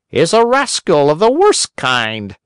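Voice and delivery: high, scolding little voice; blunt talking cricket. A narrator's reading. Talking cricket scolds the doctors, high and blunt.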